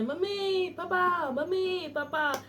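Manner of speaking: mock shout. Teacher lightly voices the lost boy's shout for parents. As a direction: lightly act out the boy calling for his parents. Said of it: "जोर जोर से चिल्लाता है".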